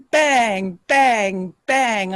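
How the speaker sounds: punchy, drawn-out door bangs; storyteller's pounding sound effect; imitated banging. A narrator's reading. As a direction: hit each BANG like a blow.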